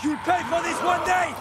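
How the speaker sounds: angry yell. Acted. NPC threatening yell.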